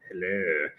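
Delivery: very low, hushed creepy hello. Real, not acted. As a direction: drop far down into a soft, creepy greeting. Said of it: "That was super creepy".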